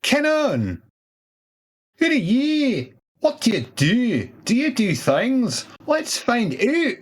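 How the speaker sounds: high, bouncy host voice; playful high ask. Acted. Host quizzing a guest, high and playful.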